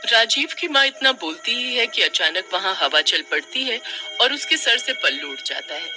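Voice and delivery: plain reading voice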